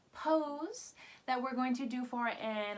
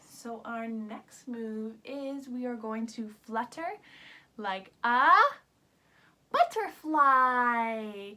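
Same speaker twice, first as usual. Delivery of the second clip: slow and louder on butterfly; slowed, louder butterfly announcement; slow reveal; 3 semitones lower, 6 dB louder than usual. Shown as a demonstration. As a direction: stretch out the big reveal. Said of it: "slowed reveal".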